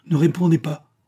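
cry out. Acted. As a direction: shout it out to cut him off. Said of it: "s'écria-t-elle". (s'écrier plus haut et plus fort)

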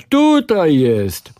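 slow, drawn-out loud tutaj; loud call. A narrator's reading. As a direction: point and call him out. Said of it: "krzyknął grubas".

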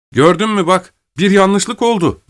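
high-pitched, vindicated prisoner; lifted, told-you-so delivery. Acted. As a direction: point out the mistake triumphantly. Prisoner vindicated, pointing out the mistake.

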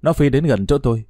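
plain reading voice

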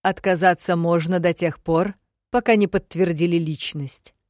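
loud, formal public voice; official explanation. Acted. Secretary explains the rules to the barbarian, loud and formal. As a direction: project the rule like an official.

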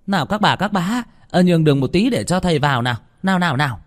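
high, brisk crowd-clearing call; bustling, insistent calling out. A narrator's reading. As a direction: urge people to make way. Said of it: "Bà Hương lớn giọng".

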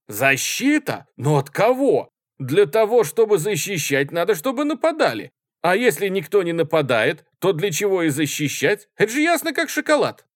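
high, loud argumentative tirade; shouting. A narrator's reading. Student disputing the officer's answer, shouting. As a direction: hammer the argument across the room. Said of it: "кричал студент в тужурке".

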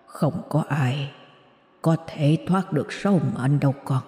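low, soft, slow voice from beyond; quiet, slow ghost of a grandfather; ghostly grandfather. A narrator's reading. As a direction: speak like a dead grandfather whispering behind her. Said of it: "Ông lại tiếp tục thở dài rồi lại nói".

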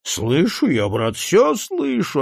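drawn-out, high tipsy reply; a slow, swooping hint of tipsiness; lightly voiced drunk. A narrator's reading. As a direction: drag it out like a drunk man. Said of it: "светившиеся пьяным безумием глаза".

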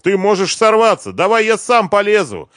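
high, loud cry of alarm; shouted warning. A narrator's reading. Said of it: "крикнул ему Машков".

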